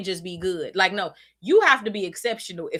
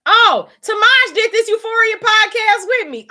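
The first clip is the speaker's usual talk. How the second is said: excited; 13 semitones higher, 9 dB louder than usual. Real, not acted. Suddenly spots chat comment.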